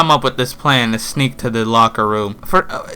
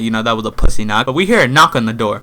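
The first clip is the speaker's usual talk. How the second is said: normal talking voice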